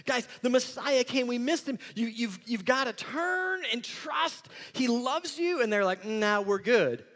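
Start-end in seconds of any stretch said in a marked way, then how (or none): 0.0-5.6 high, loud impassioned plea; acted excited proclamation, pitched way up; urgent declaring. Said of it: "he comes and he declares". 6.0-7.0 drawn-out, offhand brush-off; mock casual no-thanks; dismissive refusal. Acted casual refusal by listeners.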